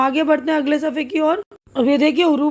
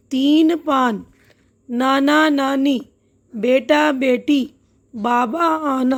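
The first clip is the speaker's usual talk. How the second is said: slow, spaced-out word list; drawn-out vowels, a pause between pairs; slow word reading; much slower than usual. Shown as a demonstration. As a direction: read each word out slowly for learners. Teacher models reading the listed words.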